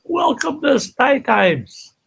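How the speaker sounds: slow, high announcer greeting; show host welcome. Acted. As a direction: stretch it out like opening a show.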